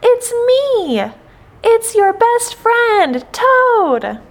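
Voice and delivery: high calling voice, words stretched out; shouting. A narrator's reading. Toad telling faraway Frog who is there, shouting. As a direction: call across a wide river to a friend. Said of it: "he shouted".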